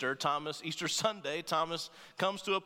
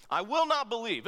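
loud, higher, stubborn refusal; defiant refusal; 7 semitones higher, 5 dB louder than usual. Acted. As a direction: plant your feet: I will not believe. Thomas refuses, acted defiant quote.

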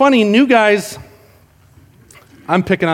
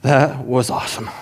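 low, hushed, impressed murmur; a newcomer quietly marvelling; awed new guy; 6 semitones lower, 5 dB quieter than usual. Retold or imitated. New guy murmurs in awe, low and hushed. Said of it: "the new guy's like".

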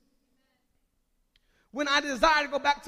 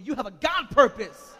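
louder, with conviction; said like you mean it; 10 dB louder than usual. Shown as a demonstration. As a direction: declare it firmly and loud. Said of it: "say it like you mean it".

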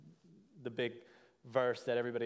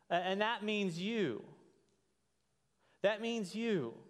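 raised, higher preaching voice; 7 semitones higher, 3 dB louder than usual. Acted. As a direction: preach it out with conviction. Preaching at full force.

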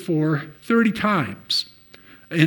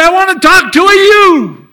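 shouting demand; 14 semitones higher, 13 dB louder, faster than usual. Retold or imitated. Retold shouting at the father.

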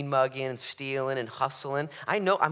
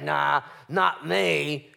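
mock denial; 3 semitones higher, 5 dB louder, much slower than usual. Congregant denying bitterness.

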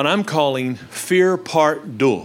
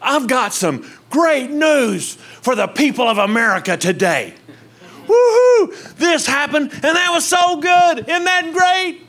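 high, bright-sounding fake good-news voice, much higher; mocking over-the-top happy news read, much higher and louder; mock cheery newscaster; 11 semitones higher, 4 dB louder than usual. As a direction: ham up a gushing anchor with a whoop. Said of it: "go to the news and hear this".

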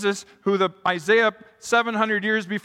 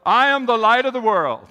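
bold proclamation; 5 semitones higher, 5 dB louder than usual. Shown as a demonstration. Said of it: "stand before them all and go".

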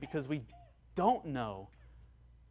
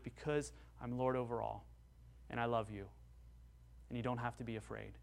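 much softer, tender reply; God reassuring; 3 semitones lower, 9 dB quieter than usual. Acted. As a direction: comfort the fearful believer. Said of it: "God would reply with".

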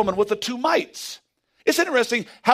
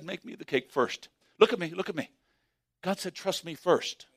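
low, soft, deliberate words; hushed voice of God; 3 semitones lower, 8 dB quieter than usual. Acted. Preacher voicing God's soft command to the widow. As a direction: speak God's command quietly, with weight.

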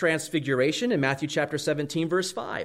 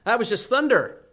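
mock scoffing naturalist; 6 semitones higher, 4 dB louder than usual.

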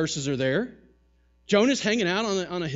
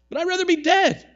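much higher and louder, a sulky outburst; petulant Jonah; 12 semitones higher, 7 dB louder than usual. Retold or imitated. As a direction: throw it at God like a sulking child. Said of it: "For it is better to me to die".

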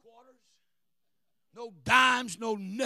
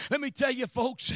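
usual speaking voice

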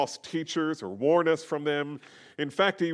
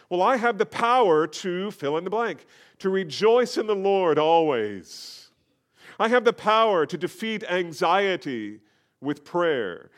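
louder, higher, ringing declaration of power; preacher voicing a believer, louder and higher, rousing; confident declaration; 5 semitones higher, 4 dB louder than usual. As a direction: proclaim each line like a believer who knows it. Believer voiced declaring God-given power.

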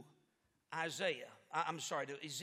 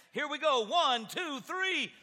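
called-out count, rising on three; preacher calls the countdown to the group; loud countdown; 5 semitones higher, 7 dB louder than usual. Real, not acted. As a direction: count the crowd in loudly.

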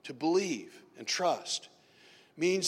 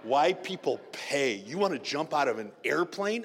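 ordinary talk

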